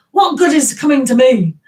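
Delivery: loud, punchy comic complaint; mock shout. Shown as a demonstration. Showing viewers how the English speak, mock shout. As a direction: belt out the question in mock protest. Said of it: "What are you shouting at?".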